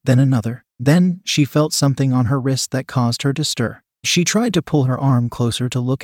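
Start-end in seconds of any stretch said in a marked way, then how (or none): none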